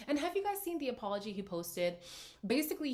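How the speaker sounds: ordinary delivery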